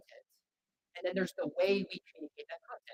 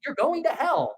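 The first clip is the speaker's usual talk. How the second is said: much louder call from the crowd; shouted; 6 semitones higher, 13 dB louder, much slower than usual. Retold or imitated. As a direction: shout it across the hall. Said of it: "Like, he shouts it out".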